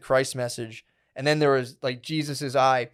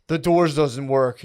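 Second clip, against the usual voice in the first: slow dazed report; 5 dB louder, much slower than usual. Retold or imitated. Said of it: "I was like".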